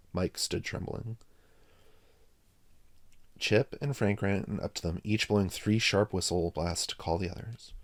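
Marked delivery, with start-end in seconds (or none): none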